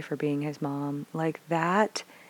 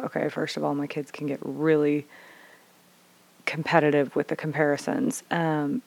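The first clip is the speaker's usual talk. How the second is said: ordinary delivery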